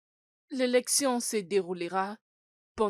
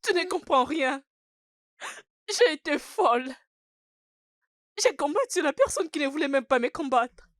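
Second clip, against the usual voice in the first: higher, louder, anguished cry of regret; distraught outburst; 6 semitones higher, 4 dB louder than usual. Acted. Girl to her friend, distraught and raised. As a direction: let the hurt burst out high and loud.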